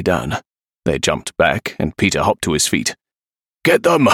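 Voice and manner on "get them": high, urgent shout; shouted order. A narrator's reading. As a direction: yell it to set your men chasing. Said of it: "Razor shouted".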